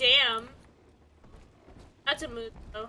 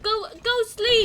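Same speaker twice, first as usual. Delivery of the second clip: coaxing; 9 semitones higher, 7 dB louder than usual. Real, not acted. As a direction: gently shoo them to bed. Telling pet to sleep.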